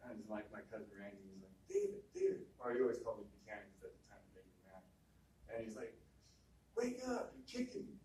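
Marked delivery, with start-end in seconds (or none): 1.7-2.5 cousin calling his name. Retold or imitated. Cousin wakes him in bed. 6.7-8.0 annoyed cousin. Retold or imitated. Cousin complains, woken by kicks.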